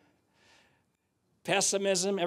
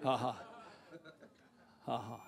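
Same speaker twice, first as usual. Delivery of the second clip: low, soft token laugh; deliberate laugh; 5 semitones lower, 12 dB quieter than usual. Shown as a demonstration. Preacher laughs at pessimism, low and deliberate. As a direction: give a quiet, put-on "ha ha". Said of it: "Let's laugh at that".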